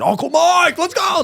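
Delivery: put-on high loud child calling out; high shouting kid voice; excited kid. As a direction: call like an eager little boy.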